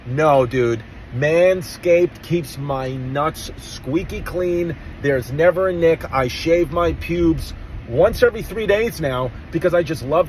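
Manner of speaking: slow, punchy hard-sell delivery; podcast ad read. Acted. Host pitching a grooming sponsor for Fourth of July, punchy ad read.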